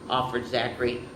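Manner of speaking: ordinary delivery, nothing marked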